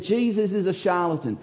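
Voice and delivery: mock the sceptics' sneer; sneering Pharisees. Preacher mimicking the scornful Pharisees, sneering. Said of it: "These were the people that said".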